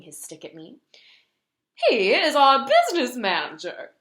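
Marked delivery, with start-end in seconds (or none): 1.7-3.9 loud, higher proud introduction; proud giraffe. A narrator's reading. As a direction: present him proudly. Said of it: "the giraffe said".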